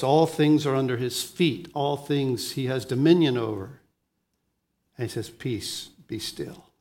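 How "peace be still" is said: soft, low word of calm; hushed calming command. Retold or imitated. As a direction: voice a gentle order to the storm. Jesus calming the storm, acted.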